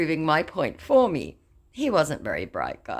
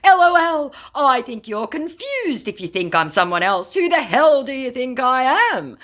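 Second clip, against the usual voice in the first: high, loud mock scammer protest; 11 semitones higher, 6 dB louder than usual. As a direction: squeal out the scammer's fake outrage. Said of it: "he goes".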